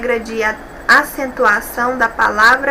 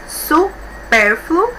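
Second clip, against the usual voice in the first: su... PÉR-fluo, slow, pitched up; syllable by syllable; 4 semitones higher, much slower than usual. Shown as a demonstration. Teacher counting syllables of supérfluo, syllable by syllable. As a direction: count out supérfluo slowly in separate pieces. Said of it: "três sílabas".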